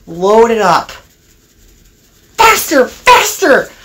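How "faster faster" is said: high-pitched mischievous goading; gleeful urging. A narrator's reading. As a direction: egg the engine on in a high voice. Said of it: "laughed the trucks".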